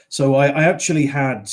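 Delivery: ordinary delivery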